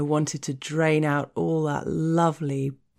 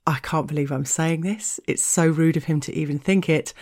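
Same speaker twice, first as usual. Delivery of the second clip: ordinary delivery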